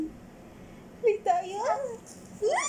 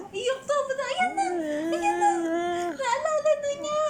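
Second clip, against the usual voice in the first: much higher and louder, rising to a squeal; teasing squeal; 9 semitones higher, 4 dB louder than usual. Real, not acted. Mother teasing her baby who is now crying and laughing. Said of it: "Iyak tawa ka na?".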